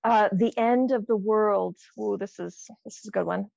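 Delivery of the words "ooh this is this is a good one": usual speaking voice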